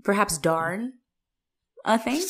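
high, prim voice of a dainty colonial daughter; a fussy old-timey girl, pitched high; sixteen thirties girl. Pretend. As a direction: play the pampered indoor maiden pleading with mother. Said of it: "sixteen thirties persona".

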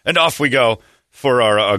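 normal talking voice

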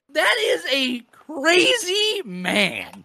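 high, loud and drawn-out exclamation; loud amazement. Real, not acted. Host reacting to a murder-confession story, amazed slow holler. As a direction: stretch each word out loudly in disbelief. Exclaims after rereading the killer quote.

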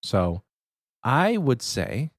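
ordinary delivery

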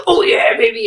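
loud, hammed-up sex talk; mock lusty man's voice; put-on bedroom voice. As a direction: burst out in a fake lover's voice. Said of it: "that's not how you sound".

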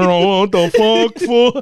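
high, loud, slow laughing cry; laughing disbelief. Retold or imitated. Telling his friend about his sad boner, loud laughing outcry. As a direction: howl it through laughter.

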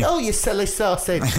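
very high, playful sweet voice; teasing wife. Retold or imitated. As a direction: tease him fondly in a high voice. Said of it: "She's like".

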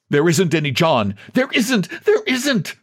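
frantic, high-pitched insistence; desperate denial. Acted. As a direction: climb to a high, desperate protest. Desperate man denies being John.

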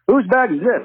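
pitched high, sharp cop question; demanding cop. Retold or imitated. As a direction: hold the bag up and demand. Cop searching the van.

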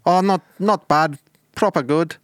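put-on Bristol accent slipping into Irish; wobbly Bristol-Irish put-on accent; attempted Bristol accent. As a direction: play a Bristol lad who sounds Irish. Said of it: "I thought you're from Bristol, not Ireland".

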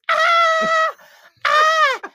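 high, loud imitated shrieks for help; mock screaming. Imitating ex-girlfriend screaming for help in car, mock screaming. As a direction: scream it out like a panicked woman. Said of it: "She starts screaming".

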